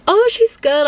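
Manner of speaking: high, overly sweet cover-up reply; mock cheerful. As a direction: chirp it up high to sound fine. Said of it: "And then I was like".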